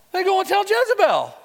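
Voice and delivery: spring the name on them; preacher building suspense, high excited reveal; dramatic reveal. Real, not acted.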